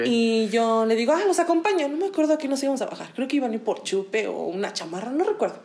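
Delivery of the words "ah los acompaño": eager offer. Retold or imitated. Retold offer, high eager voice.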